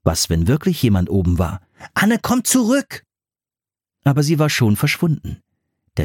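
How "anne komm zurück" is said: Rufen. A narrator's reading. Philipp ruft Anne nach.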